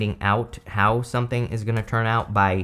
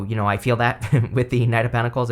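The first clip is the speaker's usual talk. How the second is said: normal talking voice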